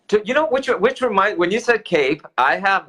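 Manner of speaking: excited. Real, not acted. Excited about his cape.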